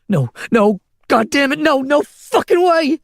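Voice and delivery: high, loud and slowing, raging grief; woman refusing to let him die, raging; anguished shout. A narrator's reading. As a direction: cry out in disbelief and fury. Man goes limp, despair.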